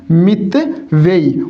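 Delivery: मितव्ययी drawn out in two separated parts; teacher's slow dictation of मितव्ययी; slow sounding-out. Shown as a demonstration. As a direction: break मितव्ययी slowly into "mit" and "vyayi". Teacher sounds word out slowly.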